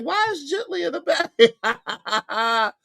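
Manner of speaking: high, slow, laughing quote; laughing retelling. Retold or imitated. Host retelling what he wondered, laughing. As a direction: laugh through your own old question. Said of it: "I was like".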